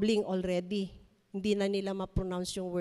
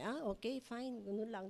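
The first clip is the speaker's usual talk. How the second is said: put-on bored kids; 11 dB quieter than usual. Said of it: "children would not say".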